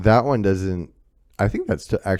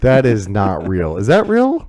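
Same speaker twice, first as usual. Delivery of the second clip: incredulous; 3 semitones higher, 8 dB louder than usual. Real, not acted. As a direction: react in real, stunned disbelief. Reacting to twins' outlandish names.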